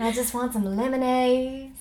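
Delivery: put-on lightly sweet Southern belle accent. Actress playing a Southern belle asking for lemonade. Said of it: "Southern Belle".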